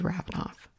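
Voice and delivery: whispered name. Acted.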